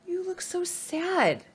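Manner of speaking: high kindly badger checking on a friend; concerned Badger. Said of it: "he says".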